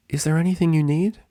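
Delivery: gentle nurse. A narrator's reading. Nurse voiced higher.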